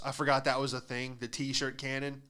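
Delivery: usual speaking voice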